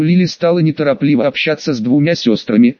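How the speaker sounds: ordinary delivery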